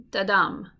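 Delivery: da-dum, short light "da" then strong "dum"; stress on DUM. Shown as a demonstration. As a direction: land hard on the "dum" of "da-dum". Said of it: "It sounds like".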